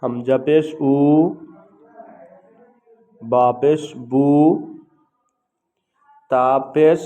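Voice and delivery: loud, slow, measured syllables with pauses between; letter-by-letter recitation drill. Shown as a demonstration. Teacher showing learners how to pronounce pesh, in a loud recitation drill. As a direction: call out each letter and its vowel slowly and loudly.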